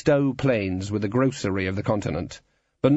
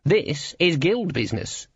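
high, slow pronouncement; menacing guild boss; 7 semitones higher, slower than usual. A narrator's reading. Guild boss closing the argument, menacing and insistent. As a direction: insist weightily, pitched up. Cleet's take of repeated words.

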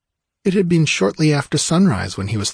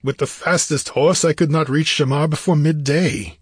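higher-pitched, emphatic warrior in frustration; frustrated warrior king; 6 semitones higher than usual. Acted. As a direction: fret aloud like a caged fighter. Narrator voices frustrated Conan.

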